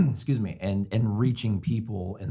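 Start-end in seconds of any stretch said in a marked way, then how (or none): 0.0--0.2 throat clearing. Real, not acted. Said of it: "just tickle in my throat".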